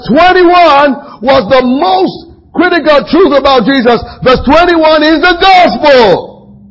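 high, loud, slow preacher's build; preaching at full force. Acted. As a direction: hammer the point home to the congregation.

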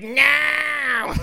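high, put-on gnome yell; gnome's shout. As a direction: imitate a gnome shouting in alarm, high-pitched. Imitates gnome's first words.

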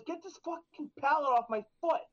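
finally fed up, she snaps the request; mock angry demand. Coworker with crushed foot snaps at inattentive man, mock exasperated.

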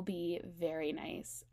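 hushed, breathy aside; whispering. Real, not acted. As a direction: whisper it like a secret. Whispered aside about quiet house.

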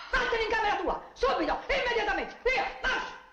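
scolding mother. Acted. Mother scolding daughter, sends her away.

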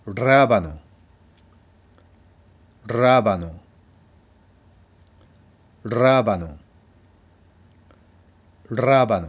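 ラバノ with a loud trilled initial R; rolled R word. Shown as a demonstration. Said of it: "では次". (巻き舌で大きく「ラバノ」)

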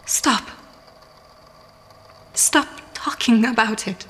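mildly strained plea, tears held back; a touch of tearfulness under a firm stop; close to crying. A narrator's reading. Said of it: "she sounded dangerously close to crying".

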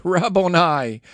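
a loud, high cry of recognition; overjoyed outcry; joyful cry. A narrator's reading. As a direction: call his name out in sudden joy. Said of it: "She turned to him and cried out".

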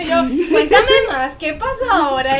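chismosa, alargando mucho las palabras; curiosidad exagerada. Retold or imitated. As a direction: pide el chisme lento y con ansias fingidas. Se imita pidiendo el chisme. (intriga teatral, arrastrada)

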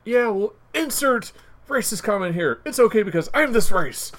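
high, loud mocking imitation; mock self-excuser. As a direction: parody someone brushing off their own offence. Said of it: "that's like when somebody says".

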